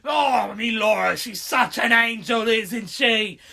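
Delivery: a lightly hammed-up cockney British geezer; cheery cockney British greeting, played for laughs; cockney British accent. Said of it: "sounds like Murdoch Nichols from Gorillaz".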